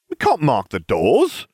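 protesting cry. A narrator's reading. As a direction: cry out in mild dismay. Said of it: "I cried".

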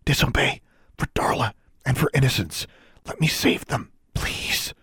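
urgent, breath-heavy whisper; whispered plea. A narrator's reading. Alexandra begs the elf to help save them, whispered. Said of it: "she whispered".

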